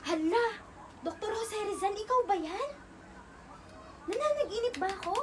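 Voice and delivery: high, loud startled disbelief; astonished. Acted. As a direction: gasp it out like you've seen the impossible. Startled at meeting Rizal's apparition.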